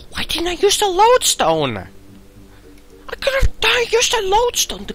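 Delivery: shrill, exasperated shout at himself; frustrated high yell. Real, not acted. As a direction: yell it in disbelief. Frustrated at own mistake.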